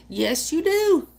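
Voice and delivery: high, loud mock agreement; cheeky high mock scolding; teasing high voice. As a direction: play it up as a teasing retort. Teasing reply to quoted line.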